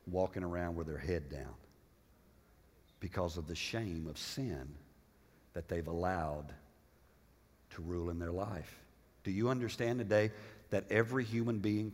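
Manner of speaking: low, soft, grave sermon delivery; quiet, low pastoral gravity; hushed, solemn preaching. Real, not acted. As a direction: bring it right down, heavy and sorrowful, with pauses.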